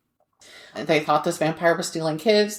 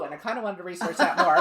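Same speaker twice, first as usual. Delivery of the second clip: usual speaking voice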